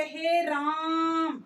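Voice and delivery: हे राम called slowly, vowels stretched to triple length; calling out. Shown as a demonstration. Teacher to children, calling हे राम with the long pluta vowel. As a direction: call हे राम as if to someone far away, drawing the vowels out. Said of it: "किसी को आवाज लगाने में".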